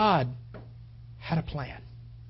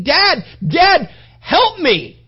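loud, higher desperate plea; son crying for help; 11 semitones higher, 13 dB louder, much slower than usual. Acted. Son in agony calling to his dad, loud pleading cry.

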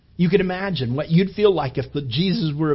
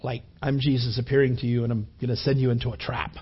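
mock sarcasm; 6 semitones lower, 4 dB quieter than usual. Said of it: "No, that's not what he was doing".

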